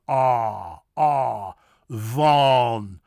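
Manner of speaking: low, loud Ah, then Van with ah; stretched Dutch Van; open vowel drill. Shown as a demonstration. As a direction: hold ah in Van. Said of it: "but Van".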